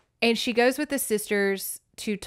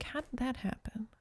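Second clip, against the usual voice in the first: much softer, hushed confusion; baffled murmur; 3 semitones higher, 14 dB quieter than usual. Retold or imitated. Reader puzzled by the plot, murmuring. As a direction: mutter it to yourself. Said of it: "And I'm like".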